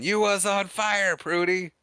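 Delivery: high, slow alarmed yell at Prudy; frantic mayor. Retold or imitated. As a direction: cry it out like a panicked mayor. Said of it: "the mayor's trying to stop her, saying".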